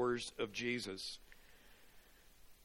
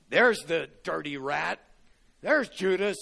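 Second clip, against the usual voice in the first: mock accusing call; 4 semitones higher, 8 dB louder than usual. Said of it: "didn't look down the table and go".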